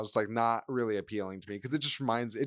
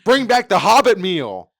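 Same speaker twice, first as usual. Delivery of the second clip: loud plea; 7 semitones higher, 12 dB louder, slower than usual. Acted. Pleading loudly to Denny's.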